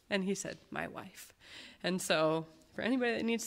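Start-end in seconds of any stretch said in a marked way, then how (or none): 0.7-1.3 whispered, much softer; a frail whisper at the very end; faint whisper. Retold or imitated. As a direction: barely breathe the words out. Weak dying man answers softly.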